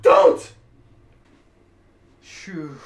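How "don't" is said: shriek, then bark don't; shrieking laugh then shout. Real, not acted.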